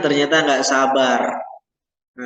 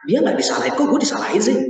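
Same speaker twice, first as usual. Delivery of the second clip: higher, whiny complaint; a student protesting it isn't fair; indignant grumble; 7 semitones higher, much faster than usual. Pretend. Student complaining she is corrected while others aren't.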